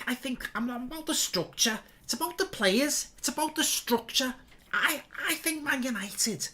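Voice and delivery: high-pitched mock Scouse accent; Jamie Carragher. Streamer doing a Scouse pundit on Man United, high put-on Scouse. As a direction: stammer it out in a squeaky, put-on Scouse. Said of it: "Jamie Carragher as my role model".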